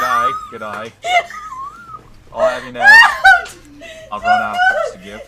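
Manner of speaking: put-on loud Aussie accent breaking into laughter; Australian accent. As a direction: belt out a mock Australian through laughs. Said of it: "doing your Australian".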